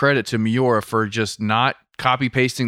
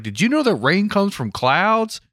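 put-on little girl voice; 8 semitones higher than usual. Voicing the daughter.

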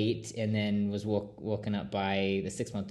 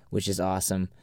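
ordinary talk

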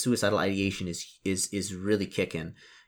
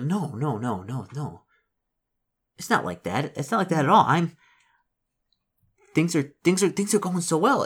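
desperate pep talk; 6 semitones higher than usual. Acted.

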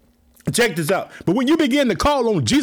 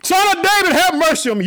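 shouted plea; 9 semitones higher, 4 dB louder than usual. Retold or imitated. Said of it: "You get up and shout".

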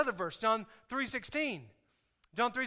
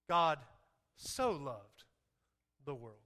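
"so loved" dropped low and soft, lingering; stress on so loved; 7 semitones lower, 4 dB quieter, slower than usual. Shown as a demonstration. Pastor to congregation, hushed stress on "so loved". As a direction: lean into "so loved" with hushed weight. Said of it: "It wasn't that God just loved".

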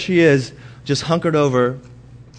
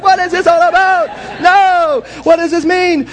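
much higher, louder exasperated Seinfeld whine; Jerry Seinfeld impression; 6 dB louder than usual. Husband arguing with his wife as Jerry Seinfeld, whiny and loud. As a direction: do Seinfeld arguing, high and indignant. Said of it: "I turn into Jerry Seinfeld".

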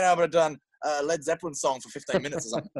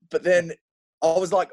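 ordinary talk

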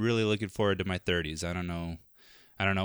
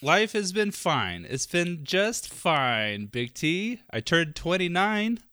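playful mock lament, higher and slow; mock dramatic; 8 semitones higher, slower than usual. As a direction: ham up turning almost thirty, slow and high.

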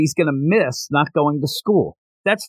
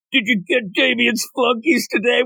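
high, mocking staff-room gossip voice; gossiping teacher; 7 semitones higher than usual. Teachers gossip in the lounge, high mocking voice. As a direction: sneer like a teacher gossiping about the losers. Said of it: "going into the teacher's lounge like".

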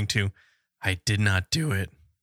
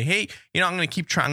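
cheery announcement; 6 semitones higher than usual. Retold or imitated. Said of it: "I'll say".

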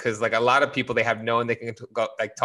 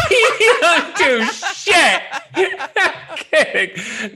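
high, very slow laughing through words; 14 semitones higher, 5 dB louder, much slower than usual. Real, not acted. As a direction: crack up while repeating it. Repeats jab while laughing.

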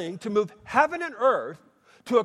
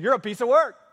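louder, teasing jab at a neighbour; playful teasing; 4 semitones higher, 5 dB louder than usual. Shown as a demonstration. Preacher has congregation tease the person next to them, playful. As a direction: tease your neighbour with a grin in the voice. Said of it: "turn to the person next to you and say".

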